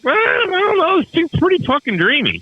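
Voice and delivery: high, amused ironic voice; wry musing self-quote. Retold or imitated. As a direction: toy with the words, amused.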